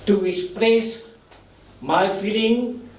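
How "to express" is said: ordinary talk, nothing marked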